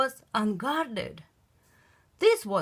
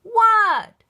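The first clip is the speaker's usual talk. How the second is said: high, loud What; loud reply; 12 semitones higher, 10 dB louder than usual. Acted. Froggy answering his dad, loud. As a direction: yell it back.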